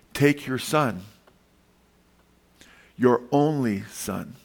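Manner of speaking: "your only son" slowed, leaning on "only"; emphasis on only son. Shown as a demonstration. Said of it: "And there's emphasis there".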